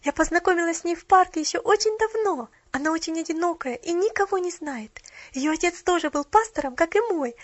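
put-on high, loud child prattle; excited chatter. A narrator's reading. Child explaining her new friend, chattering excitedly. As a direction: rattle on eagerly, barely stopping. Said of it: "затараторила она".

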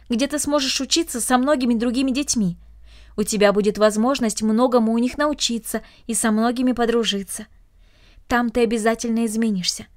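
ordinary delivery, nothing marked

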